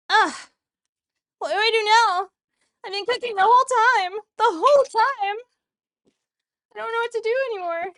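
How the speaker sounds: high, loud, hurried fluster. Real, not acted. Cook at a loss after cooking the whole time, high and flustered. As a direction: raise the pitch and rush, at a loss.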